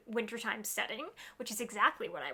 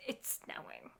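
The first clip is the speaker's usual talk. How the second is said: low whisper; 8 dB quieter than usual. Real, not acted. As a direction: whisper it excitedly. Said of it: "being kind of quiet".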